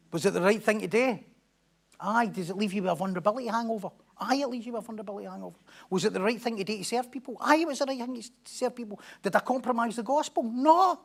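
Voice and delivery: loud, high, driving preacher's climax; preaching at full force. Real, not acted. Pastor urging the church on, preaching at full force. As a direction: drive it home to the congregation.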